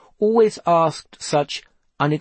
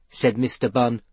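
ordinary delivery

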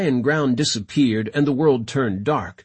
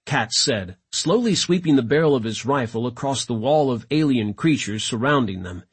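usual speaking voice

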